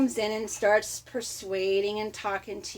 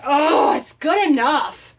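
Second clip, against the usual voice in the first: louder, slower, fed-up sigh of it's good enough; frustrated settling; 4 semitones higher, 7 dB louder, slower than usual. Retold or imitated. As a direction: give up in irritation. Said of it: "I'll get all mad and everything".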